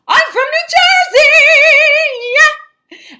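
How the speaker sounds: loud, very high, drawn-out holler; whooping hometown pride. Real, not acted. Teacher confessing New Jersey roots, whooping pride. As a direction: belt it out slowly like a cheer. Proudly announcing home state, comic outburst.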